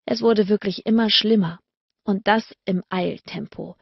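ordinary delivery, nothing marked

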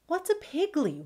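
high-pitched puppy question; puzzled puppy. Acted. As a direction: ask it in a small, high voice.

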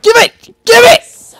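high, loud urgent yell; frantic high-pitched demand. Real, not acted. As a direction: shout it desperately in two bursts. Urgent demand during game.

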